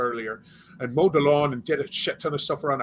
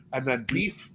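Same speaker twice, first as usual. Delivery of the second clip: usual speaking voice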